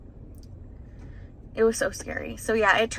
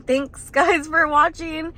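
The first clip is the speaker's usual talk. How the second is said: laughing; 6 semitones higher, 5 dB louder, slower than usual. Real, not acted. Laughs while thanking viewers.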